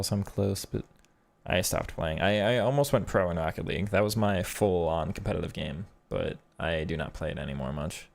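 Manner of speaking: ordinary talk, nothing marked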